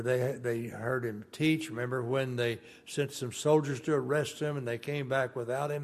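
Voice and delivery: normal talking voice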